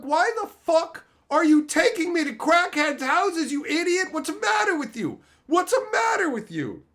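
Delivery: high, loud exasperated yell; mock angry scolding. Yelling at his friend for the crackhead visit, loud and high.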